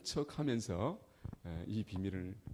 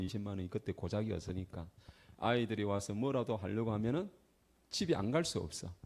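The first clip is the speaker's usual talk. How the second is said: ordinary talk, nothing marked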